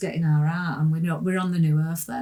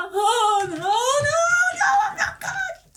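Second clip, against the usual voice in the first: high, slow, half-laughing, half-sobbing cry; retelling the mirror vision: she cries out in high, sobbing laughter; laughing crying shout; 3 dB louder, much slower than usual. Retold or imitated. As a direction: wail the denial in hysterical disbelief. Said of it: "Started going crazy".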